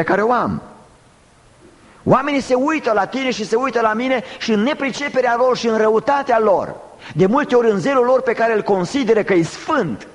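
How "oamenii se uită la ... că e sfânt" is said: high, insistent pulpit indictment; forceful preaching. Real, not acted. Preacher warning about people's zealous malice, forceful preaching. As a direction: press it out on a high sustained pitch.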